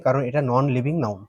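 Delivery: ordinary talk, nothing marked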